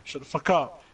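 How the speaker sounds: ordinary delivery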